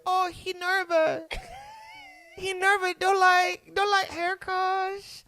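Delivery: very high, drawn-out cooing lady voice; haircut ladies. Retold or imitated. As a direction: imitate hairdressers fussing over a cute kid. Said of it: "the ladies thought I was so cute".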